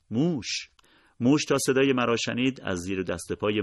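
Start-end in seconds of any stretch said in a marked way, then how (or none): 0.1-0.7 high-pitched, contained cry of alarm; involuntary cry. A narrator's reading. Narrator suddenly spots a mouse, crying out involuntarily. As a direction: blurt it out high, without real volume. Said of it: "بی اختیار داد زدم".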